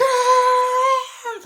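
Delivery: very high, loud scream; terrified scream. Retold or imitated. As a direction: shriek at the near crash. Sudden stop.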